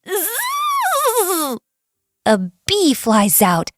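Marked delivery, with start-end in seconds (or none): 0.1-1.6 high-pitched buzzing bee; long, high zzz. A narrator's reading. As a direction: imitate a bee zipping out. Imitated bee flying out of hive.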